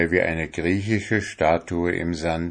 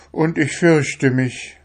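higher, fearful plea; a touch of fear in a begging voice; 8 semitones higher than usual. A narrator's reading. The soul confesses fear to the fisherman, pleading. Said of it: "bettelte die Seele".

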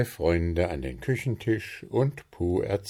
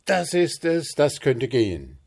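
higher and louder happy cry; rejoicing; 7 semitones higher, 6 dB louder than usual. Acted. Gnome cheers at the fancy cake name, higher and louder. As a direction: whoop it out with joy. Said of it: "jauchzte der Wichtel".

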